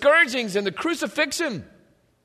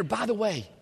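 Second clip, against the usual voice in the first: ordinary talk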